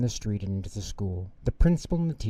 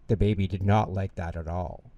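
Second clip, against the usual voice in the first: ordinary delivery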